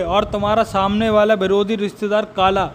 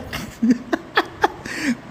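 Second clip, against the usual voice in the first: breathy laugh; 5 semitones higher, 5 dB quieter than usual. Real, not acted. Laughing at own story.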